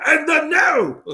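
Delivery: high, loud announcer call; ring announcer. Pretend. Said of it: "I'm gonna say that all day".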